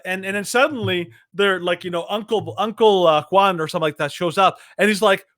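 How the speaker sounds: ordinary delivery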